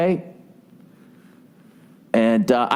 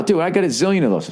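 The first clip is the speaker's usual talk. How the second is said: usual speaking voice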